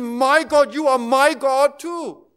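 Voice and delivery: loud, pleading questions to God; voicing Jesus, loud and anguished; anguished cry. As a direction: cry out, hurt and abandoned. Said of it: "He said".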